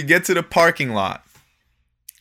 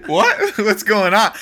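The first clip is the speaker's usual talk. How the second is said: high, startled "what" with a laugh; imitating confusion; 7 semitones higher, slower than usual. Confused friend turning to the teller after being cursed at, mock bewildered. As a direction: act out a baffled friend, amused. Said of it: "Larry looks at me confused".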